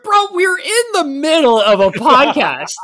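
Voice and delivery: loud, high, drawn-out protest; slow it down into an incredulous shout; mock exasperation. Host scolds friend for sending videos mid-podcast, mock exasperated.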